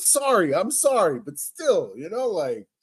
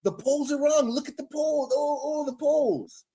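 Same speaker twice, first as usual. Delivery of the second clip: high whiny mock denial; mocking Democrats; 4 semitones higher, slower than usual. Mocking Democrats who deny bad polls, high and whiny. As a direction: mimic a pouting denier, pitched up. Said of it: "they're just like".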